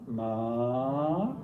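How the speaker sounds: "ma" drawn out, sweeping upward; rising tone. Shown as a demonstration. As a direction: Mandarin second-tone "ma", long steady climb. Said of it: "a rising tone".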